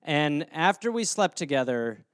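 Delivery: ordinary talk, nothing marked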